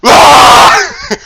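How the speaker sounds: sudden high yell to scare; mock scare shout. Popping out of the couch to scare his buddy, a loud high yell. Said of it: "And I'm like".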